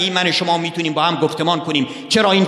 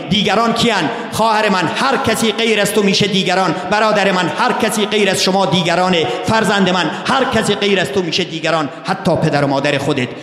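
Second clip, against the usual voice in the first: raised, ringing preacher's call to the congregation; fervent preaching; 4 semitones higher than usual. Acted. Preacher addressing sisters, brothers and children, fervent and raised. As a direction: proclaim it to each listener in turn.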